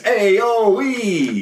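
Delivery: high, drawn-out mock Brooklyn accent; random Brooklyn vowels. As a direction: stretch nonsense vowels in fake Brooklynese. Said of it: "throwing in random vowels".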